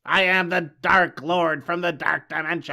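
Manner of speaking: loud, slow mock dark lord; villain voice. Podcaster imitating the movie's Dark Lord, slow villain voice. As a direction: proclaim it like a hammy movie villain. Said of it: "Our villain just starts talking like this".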